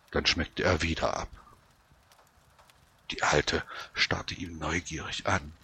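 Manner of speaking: ordinary delivery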